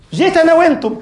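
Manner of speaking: أنا lifted high and loud; fervent outcry. Real, not acted. Preacher repeating to congregation, high fervent cry. Same words repeated with force.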